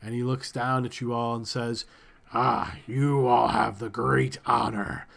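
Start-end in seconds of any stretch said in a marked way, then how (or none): 2.3-5.1 slow, gloating creature voice; lightly voiced creature leader, gloating; masked creature leader. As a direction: savour the offer of a deadly game. Masked leader addresses captives.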